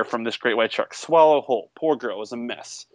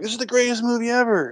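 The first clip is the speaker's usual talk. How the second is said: much higher, hammed-up rave; mock gushing fan; 10 semitones higher than usual. Fans raving about the movie on Twitter, mock gushing. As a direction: gush like an overexcited fan. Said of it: "other people were like".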